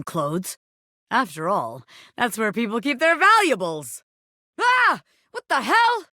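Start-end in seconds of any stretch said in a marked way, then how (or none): 4.6-6.1 sudden high startled cry; startled yelp. Acted. Bobby Pin startled by a sudden attack. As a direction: jump at the scare.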